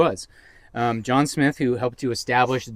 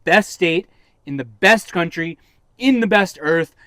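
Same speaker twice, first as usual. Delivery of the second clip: rising, louder mock-patriotic build to a choked ugh; getting emotional; 4 semitones higher, 7 dB louder than usual. Real, not acted. Host hams up home-state pride, building and loud. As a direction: swell with hammed-up pride. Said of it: "I'm sorry I'm getting emotional here".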